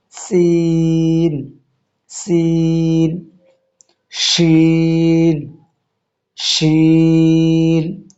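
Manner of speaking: সিন and শিন drawn out very slowly, hiss held; slow sin and shin. Shown as a demonstration. Teacher chanting letters slowly for learners. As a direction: stretch each letter, hissing through. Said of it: "সিন উচ্চারণ হবে না".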